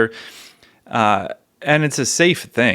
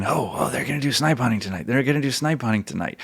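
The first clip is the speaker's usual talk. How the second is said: teasing hush; 6 dB quieter than usual. Retold or imitated. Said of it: "looking for the new kids going".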